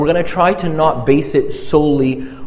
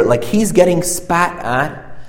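usual speaking voice

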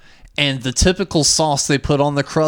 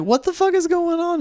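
much higher, incredulous; mock outrage. Retelling his outrage at the closed pizza place, high disbelief. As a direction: act out disbelief at a locked store.

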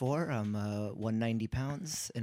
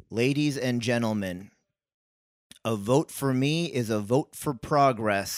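slow, slightly raised politician read; earnest campaign speech; 4 dB louder, slower than usual. Shown as a demonstration. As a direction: deliver the line like a mayoral candidate. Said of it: "I didn't really believe that you were go get 'em".

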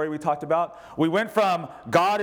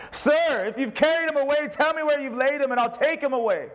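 higher, urgent read of a plea; lightly voiced pleading Mary; 10 semitones higher than usual. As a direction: beg as Mary, lifted.

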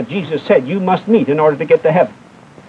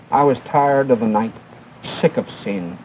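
fading despair; 9 semitones lower, 6 dB quieter than usual. Retold or imitated. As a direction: lower and softer, fading to a near hush. Dying girl's last words.

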